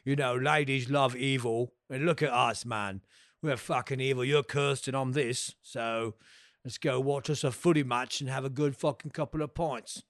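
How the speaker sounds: put-on Cockney villain, matey; Cockney attitude. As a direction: imitate a laddish Cockney inviting a mate along. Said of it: "he's got the Cockney accent".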